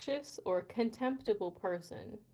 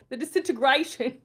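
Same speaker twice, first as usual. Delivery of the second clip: loud, higher, exaggerated British gone astray; overdone British accent; 5 semitones higher, 12 dB louder than usual. Mocking own attempt at British, loud botched accent. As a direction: belt it out in bad mock British. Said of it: "What kind of accent?".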